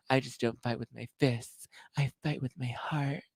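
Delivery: mock earnest hush.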